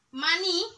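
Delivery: maní, last syllable strongest; stress on NÍ. Shown as a demonstration. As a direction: lift and hit the end of maní. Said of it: "la sílaba tónica es en la ni".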